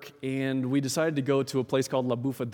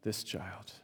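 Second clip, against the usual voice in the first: hushed echo; 4 semitones lower, 12 dB quieter than usual. Real, not acted. Pastor echoing this child to the congregation, dropping to a soft hush. Repeated phrase fading into reflection.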